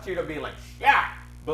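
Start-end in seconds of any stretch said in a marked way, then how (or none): 0.8-1.1 a sudden loud, high shout; mock celebration, belted out; a loud triumphant shout; cheering shout. Acting out his own cheer.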